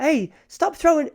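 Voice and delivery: put-on high, quick protest, groping for a Lebanese accent; attempted Lebanese accent. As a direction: imitate a Lebanese man, accent not found yet. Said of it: "how does a Lebanese accent go?".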